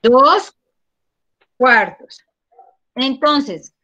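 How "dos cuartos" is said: second try, Dos cuartos said louder and firmer; louder retake. Real, not acted. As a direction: repeat it clearly so everyone hears Dos cuartos. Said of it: "Bueno, otra vez". (repetido más fuerte y claro)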